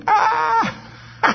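very high, mock burst of laughter; imitated laugh. Preacher acting out Sarah's reaction to God's promise, high mock laughing. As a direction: squeal out a put-on laugh. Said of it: "I can almost hear it".

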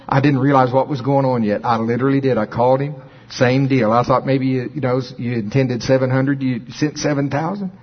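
normal talking voice

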